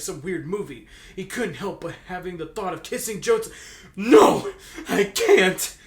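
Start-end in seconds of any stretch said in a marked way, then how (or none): 4.0-5.7 shouting in his head. Acted. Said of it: "he shouted in his head".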